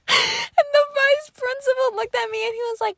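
high squeaky voice through laughter; cracking up mid-sentence; real laughing fit. Real, not acted. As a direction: struggle to get the words out while laughing. Cracks up while telling it.

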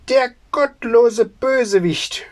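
high, indignant outburst; angry cry. A narrator's reading. Tailor denouncing his son in a high angry cry. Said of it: "schrie der Schneider".

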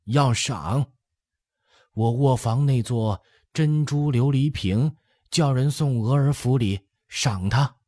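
put-on lifted, animated old-lady voice; old empress dowager, decisive. A narrator's reading. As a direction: order a reward as the elderly dowager. Dowager orders a reward.

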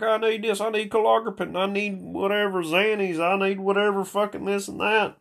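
high, pleading mock voice; mock junkie list. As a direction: rattle off the wants like the pill-hungry guy. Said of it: "It's like".

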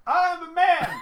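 high, loud hammed-up proclamation; mock manly boast. Dad answering the kid with a mock proclamation. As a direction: declare it like a pompous he-man.

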